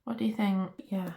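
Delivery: ordinary talk, nothing marked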